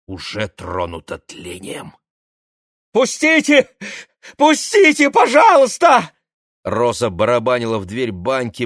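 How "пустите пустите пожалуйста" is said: high loud desperate begging; panicked pleading. Acted. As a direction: hammer on the door begging. Rosa begs at bathhouse door.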